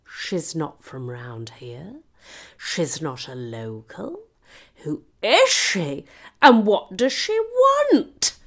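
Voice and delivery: mock a staring village, drawn out and rising to outrage; suspicious villagers. Whole village staring at the outsider, slow suspicious voices. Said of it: "whole village was staring at me, as if to suggest".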